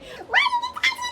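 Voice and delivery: very high squealing mock outburst; shrieked protest. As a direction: shriek it as if fed up with being touched. Acting her outburst at his touching.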